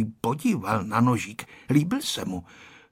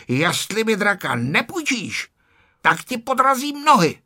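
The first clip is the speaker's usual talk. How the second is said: angry shout; 9 semitones higher than usual. A narrator's reading. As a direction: snap a threat at the other boy. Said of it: "Ferda se začal zlobit a křičel". (zlostně vyhrožovat)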